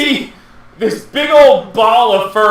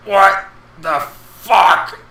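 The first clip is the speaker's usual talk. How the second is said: rough, gravelly creature voice just waking; 2 semitones lower, slower than usual. Acted. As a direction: growl it out like a dazed beast. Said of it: "in a very gravelly voice".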